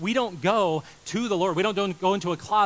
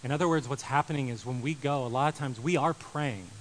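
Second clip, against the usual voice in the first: ordinary delivery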